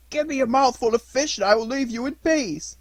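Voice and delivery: dog's plea. Acted.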